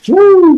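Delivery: high, loud party whoop; excited whoop. Retold or imitated. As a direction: let out a long, piercing "woo". Acted excited cheer on getting text.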